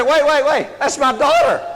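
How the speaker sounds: panicked protest. Retold or imitated. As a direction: hurry to clear your name in front of the crowd. Crowd thinks he is a pervert.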